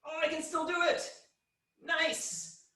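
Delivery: high, strained voice while holding arms out; straining effort. Real, not acted. Teacher straining while holding the pose for the class, high and strained. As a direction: push the words out mid-strain.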